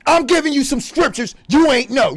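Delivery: normal talking voice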